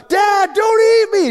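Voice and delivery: high, loud desperate shout to his dad; kid shouting. Retold or imitated. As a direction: yell at the top of your lungs so a giant can hear you. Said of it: "so he's shouting, he goes".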